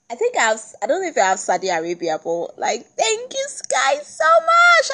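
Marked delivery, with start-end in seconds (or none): 2.9-4.9 high, slow gushing thank you; squealing thanks to listeners worldwide. Real, not acted. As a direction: sing out the thanks in delight. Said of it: "gushing thanks".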